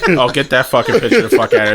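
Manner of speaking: usual speaking voice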